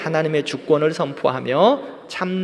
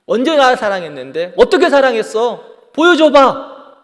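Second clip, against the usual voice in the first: defiant demanding; 9 semitones higher, 8 dB louder than usual. Retold or imitated. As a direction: push harder with each question, dragging out 보여줘봐. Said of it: "이렇게 따지고 있는 거예요". (점점 높고 크게 따지며 보여줘봐를 천천히)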